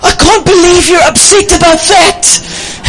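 a hammed-up impression of his wife in disbelief; imitating wife. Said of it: "Then she tells me".

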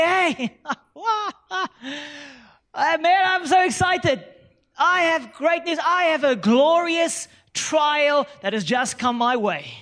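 high, bright, overjoyed caller; mock excited phone call. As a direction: play a gushing friend with great news. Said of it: "you phone a friend".

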